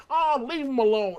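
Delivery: mock the president's Southern drawl, easygoing; Southern drawl, lightly imitated. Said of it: "in a southern drawl".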